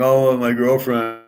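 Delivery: slow mock whimper over a lost girlfriend; mock crying. Mocking men who cry over a girlfriend, slow fake whimper. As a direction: whine it like a fake sob, slow. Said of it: "Now I'm not crying".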